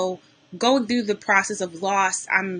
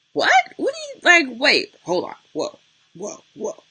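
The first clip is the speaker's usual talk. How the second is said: shocked disbelief; 4 semitones higher than usual. Retold or imitated. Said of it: "And I'm like".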